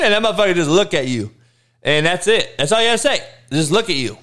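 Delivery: usual speaking voice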